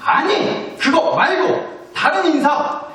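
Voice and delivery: high-pitched, acted maternal scolding; angry mother. Mother demanding the right five-syllable greeting, acted anger. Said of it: "아들한테 확 화를 내면서".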